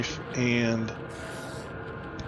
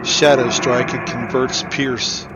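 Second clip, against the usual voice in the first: ordinary delivery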